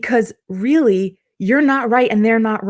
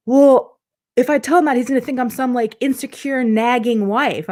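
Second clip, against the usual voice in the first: a wife afraid to sound insecure, voiced higher; anxious client; 4 semitones higher than usual. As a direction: voice her fear of looking needy, pitched up.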